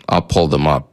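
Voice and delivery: ordinary talk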